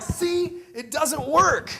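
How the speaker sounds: very high mock child voice; protesting kid, imitated. Son complaining to dad after a failed try, high-pitched protest. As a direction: squeak out an exasperated I-told-you-so.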